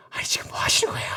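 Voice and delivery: hushed whisper of protest. Shown as a demonstration. Soldiers whispering their protest to David. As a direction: whisper it so Saul won't hear. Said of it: "이렇게 말 못했겠죠, 크게는".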